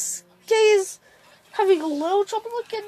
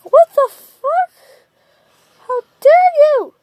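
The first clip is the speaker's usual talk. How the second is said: outraged yell; 9 semitones higher, 7 dB louder than usual. Retold or imitated. Sasuke outraged at Naruto joining his brother's group, yelling. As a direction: explode in outrage, much higher and louder.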